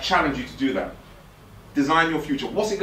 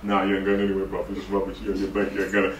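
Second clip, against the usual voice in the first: dismissive mock quote; 8 semitones lower, 4 dB quieter than usual. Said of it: "them energy vampires who are just like".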